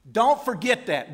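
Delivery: Don't forget that punched out loud. Shown as a demonstration. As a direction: hammer it home to the congregation.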